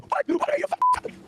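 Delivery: very high-pitched mock screech; retold screeching. Retelling Nick losing it at Kayla, mock screeching. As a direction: imitate a man screaming, pitch shooting way up. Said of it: "he's screaming, he's screeching".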